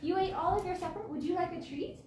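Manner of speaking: high sweet pet voice; talking to a dog. Real, not acted. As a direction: coo at the dog.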